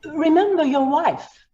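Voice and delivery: high, insistent reminder; pointed threat. Acted. Lawyer pressuring the judge through his wife, pointed. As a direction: press hard on his weak spot.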